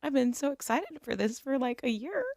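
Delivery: soft, sulky little complaint; hushed mock sulk. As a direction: mimic someone quietly sulking over a letdown. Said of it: "he was just sulking".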